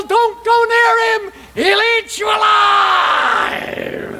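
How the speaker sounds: very high, drawn-out warning shout; yelling. Acted. Townspeople warn boy and father off the giant, high yelling. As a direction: shout a warning, stretching the last word out. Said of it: "People yell".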